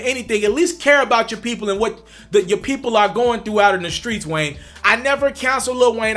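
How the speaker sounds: ordinary talk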